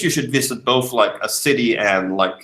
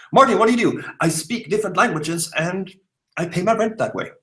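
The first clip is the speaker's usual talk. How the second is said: high-pitched chat quote; 8 semitones higher than usual. Pretend. Imagined chat with viewer.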